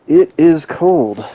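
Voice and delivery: it is cold stretched slowly and loud; emphatic. Real, not acted. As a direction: lean hard and slow on "it is cold". Stressing how cold it is.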